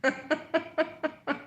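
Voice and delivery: high, rapid staccato giggle; real giggling laugh. Real, not acted. Reaction to card drawn.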